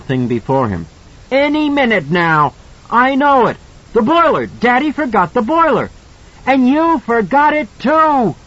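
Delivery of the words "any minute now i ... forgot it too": put-on high, loud triumphant child's voice; boy gloating about the boiler; fierce excited shouting. A narrator's reading. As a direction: crow it at the monster. Danny taunts the monster.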